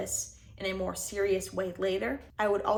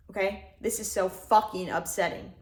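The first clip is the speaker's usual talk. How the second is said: angry gasp; 3 semitones higher, 8 dB louder than usual. Shown as a demonstration. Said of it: "that's my alternative".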